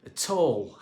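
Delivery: usual speaking voice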